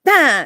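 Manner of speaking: loud, high mock scoff; dismissive "bah" noise. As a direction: brush them off with a blurted noise. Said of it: "and he goes".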